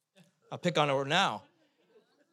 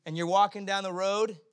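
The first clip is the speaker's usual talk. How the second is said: normal talking voice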